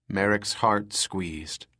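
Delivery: plain reading voice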